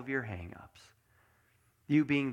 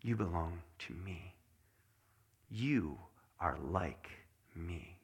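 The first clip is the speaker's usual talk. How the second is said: tender voice of God; 6 semitones lower, 6 dB quieter than usual. Acted. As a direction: lower and much softer, a gentle God. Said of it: "I have said".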